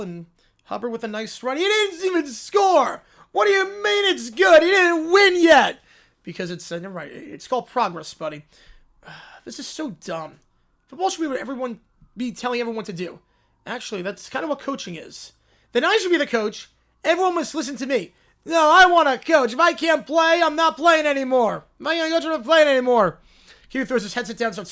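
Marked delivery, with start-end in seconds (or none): 1.6-5.8 put-on high, loud childish yell; angry kid shout. Cartoon kid yelling at Schlereth about the score. 15.7-23.2 put-on loud, high childish demands; whiny kid tantrum. Kids squabbling over who gets to coach.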